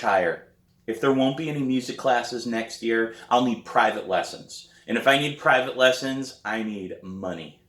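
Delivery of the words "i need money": ordinary delivery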